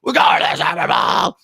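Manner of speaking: high, slow, hoarse-edged stadium shout; celebrating fans yelling at each other; retold fan yell. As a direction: scream it like the game just ended. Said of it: "We're just like".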